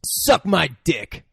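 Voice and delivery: loud, slow, drawn-out taunt; mock tough-guy defiance; acted defiant insult. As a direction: spit it out slowly, every word punched. Retold suspect insulting the cop.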